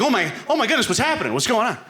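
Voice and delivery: high mock panic; startled awake. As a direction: jolt awake in mock alarm. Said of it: "I'm like".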